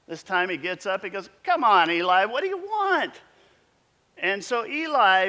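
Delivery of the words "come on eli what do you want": exasperated. Acted. Frustrated boy woken third time.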